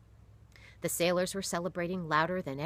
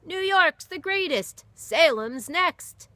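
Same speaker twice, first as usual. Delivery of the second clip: high, loud jeering shout; yelled taunt; 12 semitones higher, 11 dB louder, slower than usual. Acted. Heckler yelling back at the Salem crowd, high and loud. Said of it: "Someone yelled".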